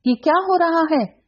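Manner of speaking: high, sharp shout; shouted warning. A narrator's reading. Begum shouting at the maid, high sharp warning. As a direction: cut in with a commanding shout. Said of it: "बेगम ने चिल्लाकर".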